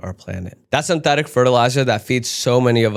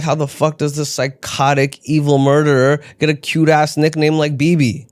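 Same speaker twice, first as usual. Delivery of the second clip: higher, strained indignant rant; mock outrage; 5 semitones higher, 3 dB louder than usual. Comic retelling his reaction to the news, higher mock rage. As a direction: sputter in comic fury at the nickname. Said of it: "I was like".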